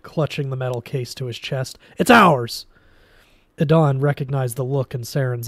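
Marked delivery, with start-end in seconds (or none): 2.0-2.7 loud, high claim; possessive yell. A narrator's reading. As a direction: clutch it and yell. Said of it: "Quan shouted".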